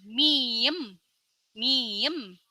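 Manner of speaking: miem said with a long m; letter name demo. Shown as a demonstration. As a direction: model miem twice.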